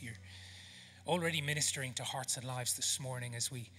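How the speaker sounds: soft, low, unhurried prayer; hushed pastoral prayer with pauses. Real, not acted. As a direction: pray gently over the room, letting phrases settle. Leads the congregation in prayer.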